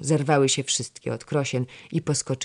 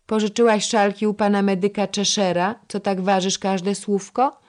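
higher, louder cutting comeback; louder retort; 7 semitones higher, 6 dB louder than usual. A narrator's reading. Lady-in-waiting mocking her senior's careful words, louder retort. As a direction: snap back mockingly at the scolder. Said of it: "głośniej trochę odcięła Jagna".

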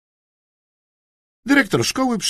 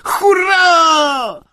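high, loud whoop; excited cheer; 9 dB louder than usual. A narrator's reading. Boy seeing the dart gun, cheering.

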